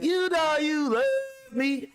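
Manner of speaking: high, slow, sing-song taunt; teasing girl voice. Retold or imitated. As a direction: tease him in a high girly voice.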